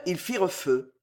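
plain reading voice

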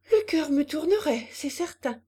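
mildly frightened lady; frightened air. A narrator's reading. Madame de Berle watching the swing, a touch of fright. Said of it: "d'un air effrayé".

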